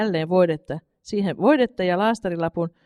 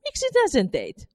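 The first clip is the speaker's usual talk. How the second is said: much higher, baffled demand; astonished. A narrator's reading. Mother demands why her son did it, astonished. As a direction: ask why, still stunned. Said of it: "huusi äiti yhä hämmästyneempänä".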